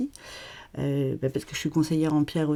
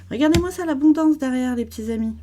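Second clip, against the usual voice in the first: higher and louder, delighted; eager show-and-tell; excited; 7 semitones higher, 5 dB louder than usual. Real, not acted. Showing the cards behind her.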